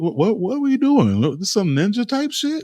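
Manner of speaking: what are we even doing; incredulous. Real, not acted. Mocking drop toe hold.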